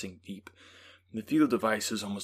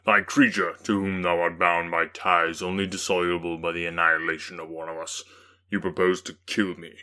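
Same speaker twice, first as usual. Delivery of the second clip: the demon; 2 semitones lower, 7 dB louder than usual. A narrator's reading. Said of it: "said the demon".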